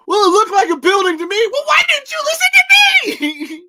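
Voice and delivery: high, loud exasperated yell; Tremors movie impression. Host acts out a movie quarrel, indignant high-pitched shouting. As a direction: rise to an indignant high scream. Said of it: "do 'em exactly in the voice".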